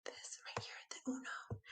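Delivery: secret whisper. Pretend. Teacher whispering a secret to the kids.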